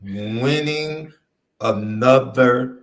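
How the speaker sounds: very slow, word by word. Shown as a demonstration. Slowing down to repeat it for his co-host, very slow. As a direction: spell it out slowly for him. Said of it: "You want me to say it slower?".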